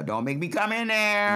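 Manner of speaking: loud, high-pitched threat; mom's warning. Acted. Mom warning scrapping kids, loud high holler. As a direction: holler it at kids in another room. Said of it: "I would go".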